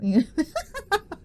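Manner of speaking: squeaky breaking giggle; high giggle. Real, not acted. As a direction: giggle at yourself. Breaks into laughter.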